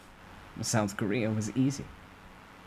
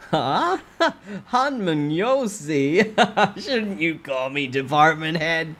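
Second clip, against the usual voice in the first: mocking laugh; 7 semitones higher, 6 dB louder, slower than usual. Boss mocks with laughter.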